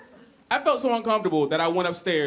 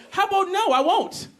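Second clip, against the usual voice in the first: high indignant pleading; incredulous rant; 10 semitones higher than usual. Acted. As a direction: lay into the bad answer. Ranting at friend's comeback.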